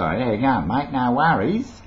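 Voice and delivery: run-together, broad Aussie greeting; casual broad Australian with rising tune; street Australian accent. Acted. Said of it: "all very well in the street".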